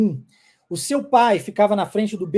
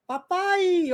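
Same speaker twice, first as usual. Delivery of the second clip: bebê acertando; 8 semitones higher than usual. Retold or imitated. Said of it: "E aí um belo dia você falou".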